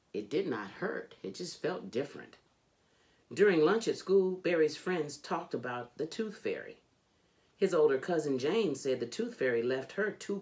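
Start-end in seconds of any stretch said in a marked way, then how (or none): none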